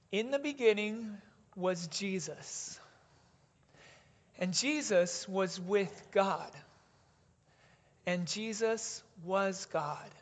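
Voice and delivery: slow, deliberate scripture reading, each phrase weighed; said slowly together. Shown as a demonstration. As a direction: let every word sink in, unhurried.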